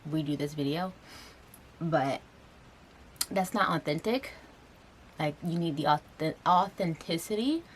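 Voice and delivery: ordinary delivery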